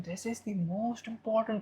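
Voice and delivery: almost whisper it, keeping it audible; near whisper. Shown as a demonstration. Speaker demonstrates vocal variation to keep listeners' attention, almost whispering. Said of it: "as you are almost whispering".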